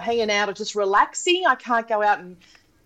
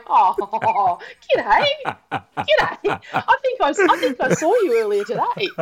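giggling g'day; laughing greeting; 4 dB louder than usual. Retold or imitated. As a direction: chuckle through a cheery hello.

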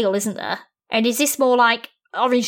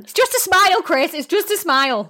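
lightly voiced pushy guy, higher; playful mock of a sleazy bloke; mocking a creepy man; 7 semitones higher than usual. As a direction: parody the man who wants a smile.

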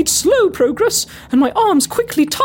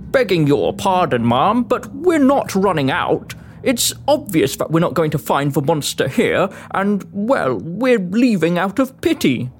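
much lower, slow working man's voice; low-pitched, deferential but firm foreman; apologetic foreman; 11 semitones lower than usual. Acted. Foreman answers his employer.

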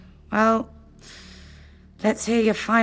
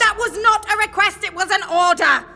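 high, stern rebuke; angry command; 8 semitones higher, 4 dB louder than usual. Acted. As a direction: pull rank on the team angrily. Leader snaps at team.

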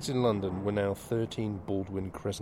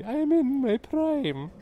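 hammed-up Irish accent, much higher and slow; 6 dB louder, much slower than usual. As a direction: stretch it out in a high Irish lilt. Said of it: "Didn't know you could do an Irish accent".

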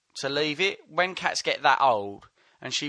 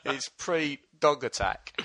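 ordinary talk

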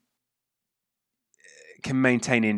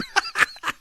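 high airy giggle. Real, not acted. Laugh after the voiced quote.

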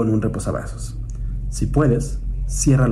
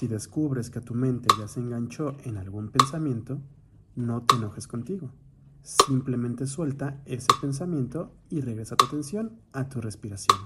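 much softer, calm meditation guidance; guided meditation; 12 dB quieter than usual. Acted. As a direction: ease them back to the breath softly. Guía la meditación en voz baja. (voz de meditación guiada, mucho más suave)

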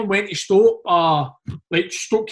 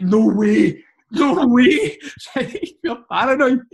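higher, louder cry of disbelief; shocked disbelief; 5 semitones higher, 3 dB louder, much slower than usual. Retold or imitated. Drunk teammate shown a fake photo, shocked disbelief. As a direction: blurt it out, stunned.

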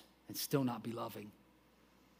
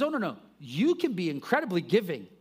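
Paul's emphatic no; 4 semitones higher, 5 dB louder than usual. Retold or imitated. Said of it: "He says".

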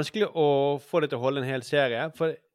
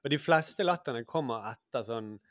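usual speaking voice

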